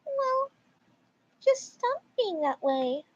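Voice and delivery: very high start, then slow and dismissive; mock a dismissive mom in a high, drawn-out voice; high-pitched put-on mom; entitled mom. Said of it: "Titled Mom".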